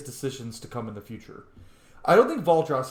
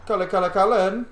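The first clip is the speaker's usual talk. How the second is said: loud, higher repeated call across the room; eager hail for a friend; 6 semitones higher, 5 dB louder than usual. Real, not acted. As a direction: call his name to get his attention. Player calling out to Cullen.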